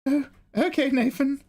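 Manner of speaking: high coaxing voice, dropping toward the end; put-on sweetheart. Sweetheart sends Nathan to pull cards on stream, high put-on voice. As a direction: send them off in a high, silly voice.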